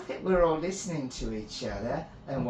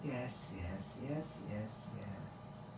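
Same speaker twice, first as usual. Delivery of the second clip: soft, slightly higher, brushing it off; dismissive priestess; 3 semitones higher, 6 dB quieter, much slower than usual. Acted. Priestess brushing Finn off, dismissive. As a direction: wave it away lightly with repeated yeses. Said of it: "waving her hands dismissively".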